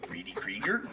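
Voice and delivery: whispered Freddy Krieger, puzzled; unvoiced puzzled whisper of the name. Real, not acted. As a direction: breathe Freddy Krieger as a question. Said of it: "whispered question back".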